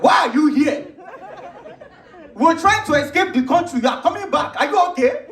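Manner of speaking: put-on high, animated Nigerian-accented cousins back home. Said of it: "Y'all see that the accent is real".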